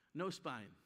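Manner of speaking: ordinary talk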